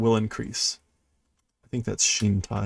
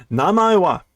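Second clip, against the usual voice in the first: demanding; 7 semitones higher, 7 dB louder than usual. Retold or imitated. Reading character line aloud.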